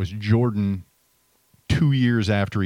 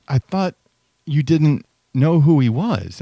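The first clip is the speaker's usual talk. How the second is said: put-on higher, louder puzzled girl's voice; 7 semitones higher, 7 dB louder, slower than usual. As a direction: quote a babysitter's puzzled challenge, pitched up. Retelling babysitter questioning the kid.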